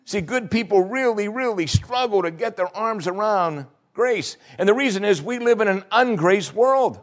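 high, ringing sermon voice; preaching at full force. Acted. Preacher telling good people they struggle with grace, high and ringing. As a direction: drive the point home to the pews.

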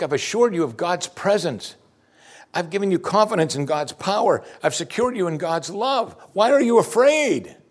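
high, pressing appeal, stretched on the question; insistent pleading. Acted. Jesus reminds doubting followers of his care, insistently pleading.

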